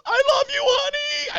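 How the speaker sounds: very high, loud mock scream; hammed-up falsetto shriek; screaming like a woman. Man dragged from car calling to partner, mock womanly scream. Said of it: "like screaming like a woman".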